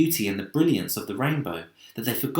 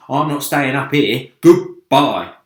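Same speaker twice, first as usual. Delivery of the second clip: louder, blurting exit; centipede bails out; 3 semitones higher, 12 dB louder than usual. A narrator's reading. Centipede leaving the others, loud and abrupt. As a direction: shout a quick goodbye and bolt. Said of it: "the centipede said".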